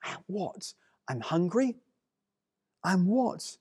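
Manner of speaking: baffled Moses. Retold or imitated. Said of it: "Moses is sort of going".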